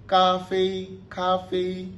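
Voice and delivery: "Café, café" on a high level tone; "café" drawn out high, like a sustained note; first-tone demo. Shown as a demonstration. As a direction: hold both syllables of "Café" high and steady. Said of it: "Premier ton, premier ton".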